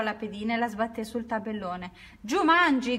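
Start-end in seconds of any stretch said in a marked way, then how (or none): 2.2-3.0 higher, louder triumphant yell; full-throated shout. A narrator's reading. Judy slams her piece down and screams the game's end at full voice. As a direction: shout it with all the breath you have. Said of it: "gridò con quanto fiato aveva in gola".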